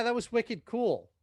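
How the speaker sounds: hammed-up Boston accent; wicked cool, Boston style. Shown as a demonstration. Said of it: "terrible Boston accent".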